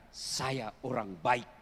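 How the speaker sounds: slow and soft, calmly; a calm, unhurried and soft statement of goodness; gentle quiet. Retold or imitated. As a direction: say it softly and slowly, with quiet dignity. Prophet's quiet reply.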